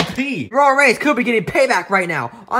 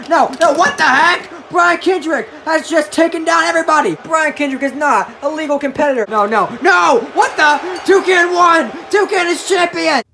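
shouting in shock at the finish; excited shouting; 7 semitones higher than usual. Acted. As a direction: yell it like a stunned ringside caller. Commentator shocked by interference.

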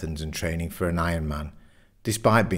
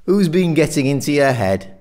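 loud, high, probing question; pressing demand; 7 semitones higher, 7 dB louder than usual. A narrator's reading. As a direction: press your mate to own up, loudly. Jeff presses Richard for answer.

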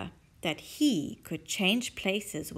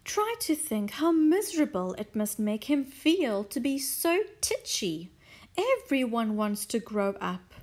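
wistful Mrs Silver; 3 semitones higher, 3 dB louder than usual. Acted.

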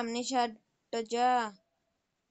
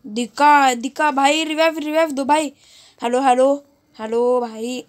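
loud frantic plea for help; panicking gamer; 2 semitones higher, 8 dB louder than usual. Real, not acted. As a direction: yell for a revive in panic. Downed, begging teammates for revive. (घबराया हुआ gamer, ज़ोर से मदद माँगता हुआ)

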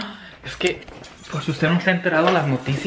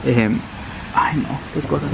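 usual speaking voice

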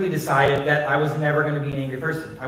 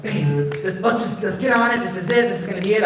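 higher-pitched eager urging, quickening; 5 semitones higher than usual. Retold or imitated. Wife urging husband onto the bus, eagerly. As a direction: coax excitedly, getting faster.

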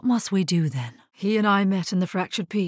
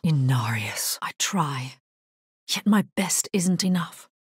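whisper. Acted. Hero admitting failure to Inarius, whispered. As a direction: confess it in a hush.

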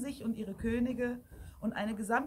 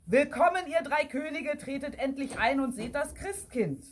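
raised, insistent welcome; loud opening, higher-pitched proclamation; energetic call; 5 semitones higher, 4 dB louder than usual. A narrator's reading. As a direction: call them in impatiently from the church. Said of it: "der energische Ruf klang".

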